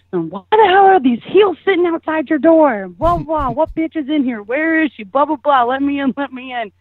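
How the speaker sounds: high-pitched imitation of a jealous tirade; mock angry rant. Ex-girlfriend confronting him about the heels, mock furious. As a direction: imitate an irate ex demanding entry. Ex-girlfriend yelling outside the closet.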